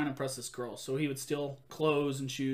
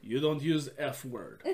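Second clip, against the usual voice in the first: lower, slower, lightly voiced giant; a slow, lower giant warning the room; low giant with a hint of a laugh; Andre voice; 3 semitones lower, 4 dB quieter than usual. Andre defends the kid.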